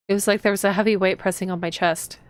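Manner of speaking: ordinary talk, nothing marked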